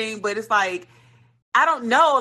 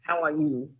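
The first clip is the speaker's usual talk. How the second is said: imitated restaurant worker's greeting; 4 semitones lower, 4 dB quieter than usual. Worker comes over to chat, lightly imitated low greeting. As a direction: lower mock-polite greeting, dropping away. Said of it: "he pushed his motherfucking bow-legged ass over there".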